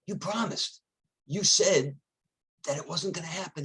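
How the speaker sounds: betrayed lion. Acted. Trapped lion protests to coyote.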